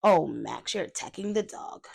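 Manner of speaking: ordinary delivery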